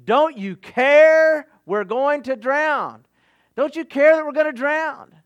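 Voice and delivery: frightened disciples shouting at the sleeping teacher. A narrator's reading. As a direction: plead loudly over the storm. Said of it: "The disciples woke him up shouting".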